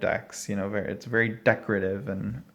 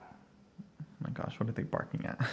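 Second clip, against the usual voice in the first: hushed whisper of amused alarm; breathy whispered aside; 12 dB quieter than usual. Real, not acted. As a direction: whisper it so the dog won't hear. Dog barking in background.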